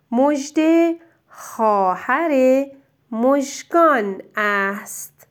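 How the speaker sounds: slow and clear; slow dictation. Shown as a demonstration. Teacher dictating the next sentence. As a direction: read the sentence slowly to be written. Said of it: "جملهٔ بعد رو گوش کن".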